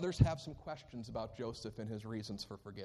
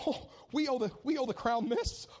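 mocking petty ruler; 12 semitones higher, 6 dB louder than usual. Imagined petty Joseph billing brothers.

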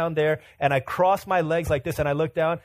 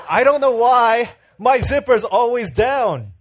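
louder and slow, laughing through it; exaggerated exasperation, drawn out and laughing; exasperated laughing complaint; 9 semitones higher, 10 dB louder, slower than usual. Pastor complains to his work team, louder, slow and laughing.

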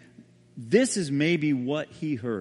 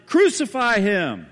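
loud, slow, high mob cry; crowd shout; 6 semitones higher, 9 dB louder, slower than usual. Retold or imitated. The crowd heard in the prison, a slow loud roar. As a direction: roar it from far off, all as one. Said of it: "the crowd shouted".

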